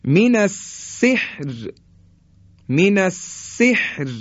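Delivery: من السحر high and slow, thin final R; light R. Shown as a demonstration. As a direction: recite من السحر slowly with a light R. (من السحر کشیده با «ر» نازک)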